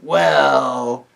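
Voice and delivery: a loud gruff dwarf opener. Acted. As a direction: bark it like a surly dwarf.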